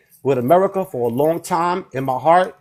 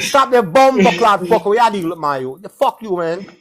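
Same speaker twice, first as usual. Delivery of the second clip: Jamaican accent; 4 semitones higher than usual. Shown as a demonstration. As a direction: bark it out in Jamaican patois. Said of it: "I could be Jamaican".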